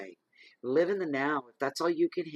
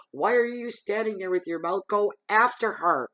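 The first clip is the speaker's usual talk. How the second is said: higher, louder, ordering him off; retold yell; 8 semitones higher, 7 dB louder than usual. Retelling yelling at her supervisor to go after her. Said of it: "I'm yelling at my supervisor".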